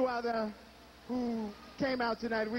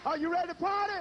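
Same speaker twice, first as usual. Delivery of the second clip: high, loud call to the crowd; big concert MC call; hype shout; 8 semitones higher, 3 dB louder than usual. Real, not acted. MC hyping the crowd.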